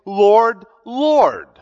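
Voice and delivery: loud, earnest calling out to the Lord; fervent cry. Retold or imitated. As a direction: cry out with fervour. Worshippers crying out to Jesus.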